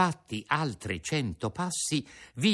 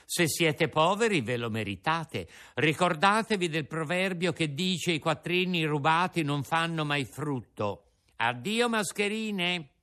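mocking Pinocchio; 6 semitones higher than usual. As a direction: wave them off with scorn. Pinocchio lectures them.

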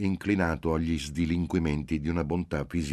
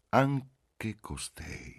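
very slow, drawn-out musing; slow pondering, pitched up; inner thought; 7 semitones higher, much slower than usual. A narrator's reading. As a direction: stretch it out as a private thought. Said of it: "pensò Marcello".

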